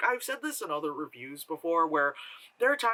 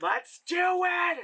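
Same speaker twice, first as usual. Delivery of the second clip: much higher, slow, loud battle cry; rally yourself like starting a big project; mock-heroic shout, stretched out; pumped up; 14 semitones higher, 3 dB louder, much slower than usual. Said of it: "I'm just like".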